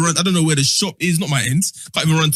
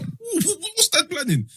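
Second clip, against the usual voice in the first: scared; 13 semitones higher, 5 dB quieter, slower than usual. Retold or imitated. Scared whisper to mum.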